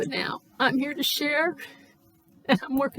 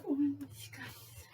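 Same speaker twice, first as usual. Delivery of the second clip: whisper; 9 dB quieter, slower than usual. Real, not acted. Whispered vlog sign-off.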